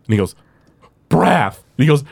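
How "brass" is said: stubborn higher-pitched insistence; mock-squabbling one-word retort; arguing. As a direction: say it like the final word. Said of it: "they both start arguing".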